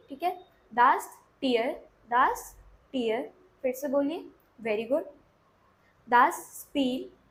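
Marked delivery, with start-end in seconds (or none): 0.7-3.3 "दास tier" slowly, word by word; repeat-after-me model. Shown as a demonstration. Teacher has students repeat along, slow repeat-after-me model. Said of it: "मेरे साथ साथ repeat करते चलिए". 6.1-7.2 "दास spill" very slowly, word by word; drawn-out "दास spill" for learners to copy; repeat-after-me model. Shown as a demonstration. Teacher has students repeat along, slow repeat-after-me model. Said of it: "मेरे साथ साथ repeat करते चलिए".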